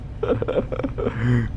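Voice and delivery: helpless, voiceless laughing. Real, not acted. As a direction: wheeze with laughter. Laughing fit continues.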